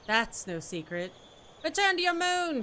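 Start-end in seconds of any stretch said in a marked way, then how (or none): 1.6-2.6 high, loud mock yell from afar. Villager yelling at an outsider across the way, loud high yell. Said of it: "she's yelling at me from across".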